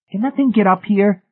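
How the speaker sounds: high, loud anxious question; nervous. A narrator's reading. David asks about the thing in the basement, nervous and high. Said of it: "David Pritchett asked nervously".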